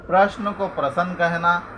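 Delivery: ordinary talk, nothing marked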